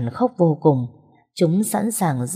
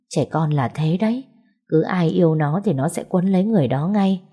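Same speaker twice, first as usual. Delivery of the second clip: ordinary delivery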